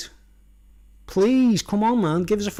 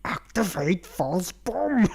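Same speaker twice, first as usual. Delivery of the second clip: slow, strained, desperate command; panicked slow plea; much slower than usual. Retold or imitated. Banana in a panic pleading with his skin, slow. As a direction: beg the suit to work. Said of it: "panic and going".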